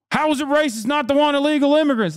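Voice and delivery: very high-pitched mock complainer voice; mocking whine. Streamer mimics a former fan turned anti-immigrant, mocking high whine. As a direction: imitate a complainer in an absurdly high squeal.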